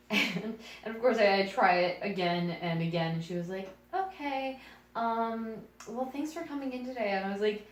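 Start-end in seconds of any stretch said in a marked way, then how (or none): 3.9-7.1 high-pitched imitation of a polite writer; retold courteous audition brush-off; polite dismissal. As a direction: let them down in a higher voice.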